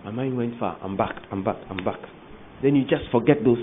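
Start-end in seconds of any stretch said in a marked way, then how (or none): 0.0-2.0 mock dreamer coming back. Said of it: "you took it just".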